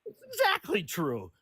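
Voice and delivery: high, drawn-out retort with a laugh; scoffing insistence. Real, not acted. Caller contradicting the host on the law, scoffing.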